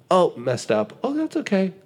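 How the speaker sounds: high, light voice shrugging off a mistake; gentle self-reassurance. Pretend. Talking to himself about a money slip, gently reassuring. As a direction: soothe yourself kindly after slipping up.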